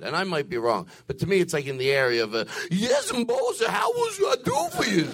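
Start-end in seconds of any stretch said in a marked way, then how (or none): 2.7-5.1 high sing-song fawning voice; subservient dialect. Retold or imitated. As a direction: belt it out like a groveling servant. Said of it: "I've joked with this dialect".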